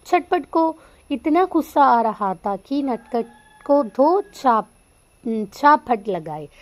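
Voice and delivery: teacher reading a tense story moment. Acted. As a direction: stress the angry moment as you read it. Reads Hindi story aloud.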